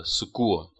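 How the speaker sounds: "school" with a hard g after the s; k voiced as g. Shown as a demonstration. Teacher demonstrating consonant voicing, "school" said with g for k. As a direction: say "school" like "sgool". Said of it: "我们读的时候要读成g".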